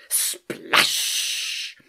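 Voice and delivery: loud, drawn-out hissing splash; explosive splash. A narrator's reading. Narrator as stone hits water hard, explosive. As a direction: burst the word out like the impact.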